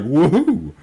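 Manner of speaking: high, loud happy whoop; excited whoop. Retold or imitated. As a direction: whoop like an overjoyed dog. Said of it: "the dogs are like".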